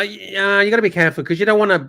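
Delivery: ordinary talk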